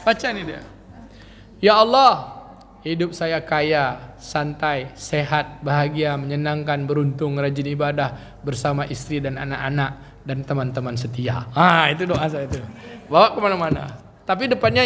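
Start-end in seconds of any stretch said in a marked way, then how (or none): 1.6-11.4 reading a prayer. Shown as a demonstration. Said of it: "Saya bacakan lah biar ibu tahu".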